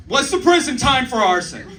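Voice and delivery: high, urgent blurt to a stranger; high-pitched, over-eager retold question.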